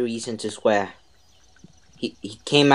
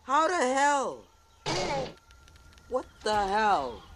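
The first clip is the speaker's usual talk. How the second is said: disbelief; 13 semitones higher, 8 dB quieter than usual. Real, not acted. Losing fight in game.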